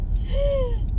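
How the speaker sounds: high imitated gasp of fright; scared cat gasp. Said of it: "she's scared all the time".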